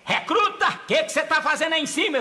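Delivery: commander yelling. Acted. Officer shouts up at the recruit in the mango tree, stern yell.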